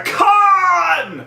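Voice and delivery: drawn-out high shout; mimicked movie scream. Citing the director's famous film cry, drawn-out shout. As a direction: bellow the name in mock rage.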